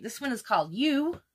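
ordinary delivery

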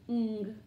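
ng as a hummed nasal, as in king; cluster sound. Shown as a demonstration. Teacher drilling consonant clusters, ng as nasal hum. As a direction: hum ng with no letter names.

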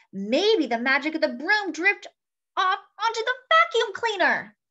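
high, eager witch voice; excited cry. A narrator's reading. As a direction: cry it out with hope. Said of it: "Maggie cried".

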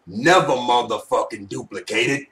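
low raspy put-on voice; smoker's rasp. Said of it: "man, I can't do that voice too long".

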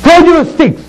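God's command. Acted. He told me.

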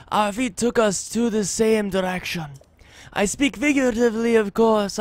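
put-on lilting, thickly accented bard voice; measured, musical accented delivery of a wandering minstrel; travelling bard with foreign accent. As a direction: read it as a courtly accented bard.

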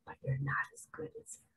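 fast hushed whisper; God's voice as a quick whisper; whispered divine voice. Acted. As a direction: breathe it out quickly. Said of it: "the king of the universe just said".

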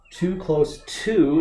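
slow, careful "Too close to," with "to" leaning into the next word; slow corrected reading. Shown as a demonstration. Teacher reading the corrected phrase to viewers, slow and deliberate. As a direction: read "Too close to," slowly and evenly, a teacher showing the fix. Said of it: "So it should be T O".

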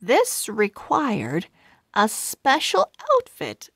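plain reading voice

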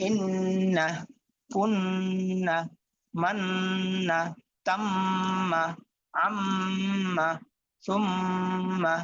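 slow words with a long nasal hold on the doubled ন and ম; held ghunnah. Shown as a demonstration. Teacher drilling students on ghunnah, slow nasal hold. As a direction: stretch out the nasal hum in ইন্না, কুন্না, মান্না, তাম্মা, আম্মা, সুম্মা. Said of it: "আমি গুণাগুলা কম করে করতেছি".

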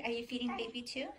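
normal talking voice

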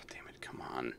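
sighing, barely audible whisper; muttered frustration. Real, not acted. Player frustrated as game fails, muttered curse. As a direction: sigh and curse under your breath.

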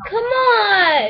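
high pleading yell; whiny shout. Real, not acted. Kid yelling at brother to hand back tablet.

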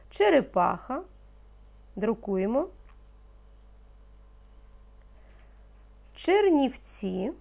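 teacher dictating single words, slow and distinct; slow dictation. Acted. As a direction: give each word slowly so children can write. (повільний диктант учителя)

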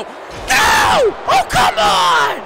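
high, outraged scream; screamed protest. Real, not acted. Commentator protests a brutal move, screaming. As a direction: scream out in disbelief.

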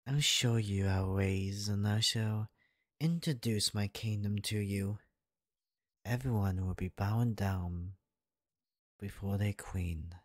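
ordinary delivery

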